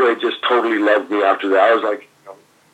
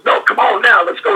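higher, louder mock urging; imitating Dusty; 9 semitones higher, 4 dB louder than usual. Dusty telling the rookie to wait, raised and pushy. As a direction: hurry him along in Dusty's voice. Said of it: "And Dusty goes".

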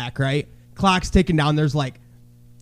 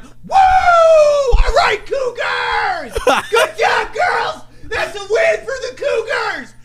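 hyping cheer; slower than usual. Retold or imitated. Parent mock-cheering the girls' team from the stands. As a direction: whoop it up for the team. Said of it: "I started hyping it up big time".

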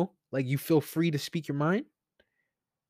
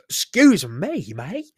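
a put-on British accent, drawn out; mock British accent; 2 semitones higher, 8 dB louder, slower than usual. Shown as a demonstration. Podcaster slipping into his British accent for listeners. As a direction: try out a British-Australian accent on "mate". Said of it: "talk in my, in my Australian accent or my British accent".

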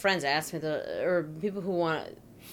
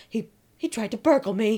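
put-on old-timey lady voice; 4 semitones higher, 3 dB louder than usual. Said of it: "a woman would be like".

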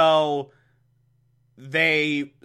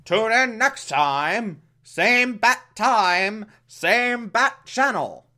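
high slow TV announcer; 6 semitones higher, slower than usual. Acted. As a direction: ham up the old sign-off. Classic show sign-off.

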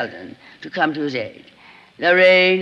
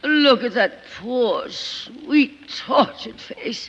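gushing glamorous actress; 6 semitones higher than usual. Acted. Lorraine swooning over Sheridan in his chair, high and gushing. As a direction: pour it on like a theatrical star.

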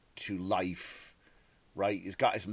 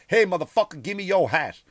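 loud, higher aggressive demand; demanding yell; 9 semitones higher, 8 dB louder than usual. Retold or imitated. Homeless man yelling for the comedian's hat. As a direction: shout it like a street threat. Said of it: "One goes like".

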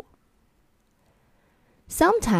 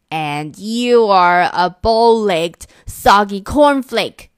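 slow, loud, deliberate insult; shouted insult; 6 dB louder, slower than usual. Acted. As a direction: hurl it word by word. Monsters trading insults.

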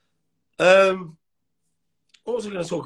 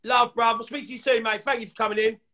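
normal talking voice

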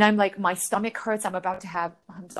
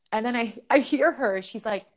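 ordinary delivery

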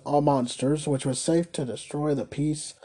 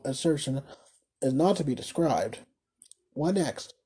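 ordinary delivery

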